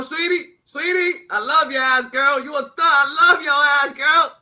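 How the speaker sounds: high, loud, slow sweetie calls; loud affection. Real, not acted. Calling her friend sweetie loudly with love. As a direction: gush over her loudly.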